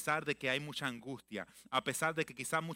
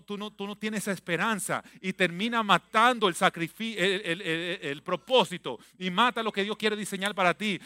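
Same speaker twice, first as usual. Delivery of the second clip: loud, building sermon climax; preaching; 4 semitones higher, 10 dB louder than usual. Acted. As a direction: rise to a fervent peak. (clímax de predicación)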